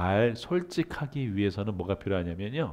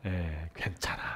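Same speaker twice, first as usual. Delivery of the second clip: reassuring affirmation; 3 semitones lower, 4 dB quieter, slower than usual. Shown as a demonstration. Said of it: "여러분 따라해서 볼까요?".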